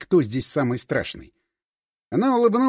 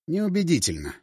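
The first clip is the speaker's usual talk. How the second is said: plain reading voice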